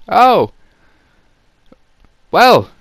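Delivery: high, loud mock-amazed "Oh! Well!"; sarcastic exclamation. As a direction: gush in fake amazement. Sarcastic mock praise of Harry.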